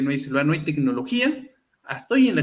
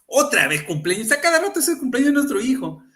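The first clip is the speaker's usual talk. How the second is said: higher mock complaining dad voice; exasperated dad; 8 semitones higher than usual. Dad grumbling to his wife about yet another birthday, high mock whine. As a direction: whine it like a fed-up father. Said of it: "Y el papá dice".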